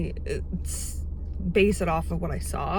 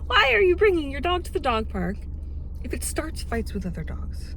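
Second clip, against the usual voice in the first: exasperated; 7 semitones higher than usual. Retold or imitated. Confronting dog owner, exasperated.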